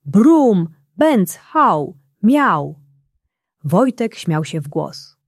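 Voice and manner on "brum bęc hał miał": louder, higher playful car sound effects; funny little car going vroom and bang; toy car noises. A narrator's reading. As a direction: burst out with the cartoon car's noises. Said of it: "który robił".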